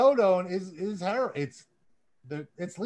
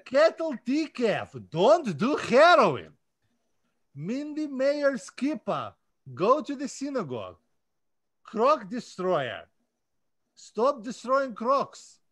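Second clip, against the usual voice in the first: put-on Russian accent; 4 semitones higher than usual. Reading fan names aloud to listeners in a light mock Russian accent. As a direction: lightly play a Russian announcer. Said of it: "I'm gonna do it with a Russian accent for you".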